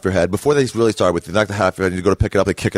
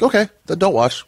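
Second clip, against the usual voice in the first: dismissive retort; 11 semitones higher than usual. Retold or imitated. Mocking reply to TV critics.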